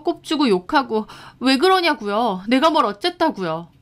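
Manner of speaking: indignant, hurt outburst; upset protest. Acted. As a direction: pour out pent-up grievance. Victim protests being bullied.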